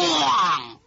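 loud shouted bang sound; mock door crash. Door slammed open.

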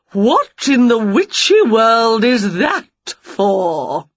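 loud, slow, swooping aunt voice; domineering aunt. A narrator's reading. Aunt confronts Winnie about the peg, loud slow disapproval.

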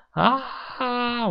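high, drawn-out narrated scream; shrill scream. A narrator's reading. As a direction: scream high without much volume. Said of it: "我尖声叫道". (拉长声音尖叫)

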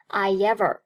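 "I ever" joined by a y sound; linked with glide. Shown as a demonstration. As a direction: slip a y between "I ever". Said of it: "中间夹了烟那个音".

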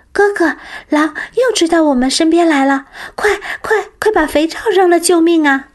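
high, loud cry for help; panicked shouting. Acted. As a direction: scream to your brother in panic. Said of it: "她朝哥哥喊道".

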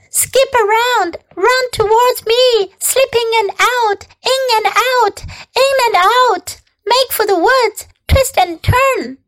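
high, urgent goose orders; commanding goose. A narrator's reading. Goose directs the pig's escape, commanding. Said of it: "began to give orders".

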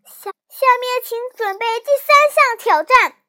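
loud, high stammered call; shouted announcement. A narrator's reading. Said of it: "小南瓜喊".

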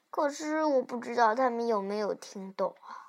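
ordinary delivery

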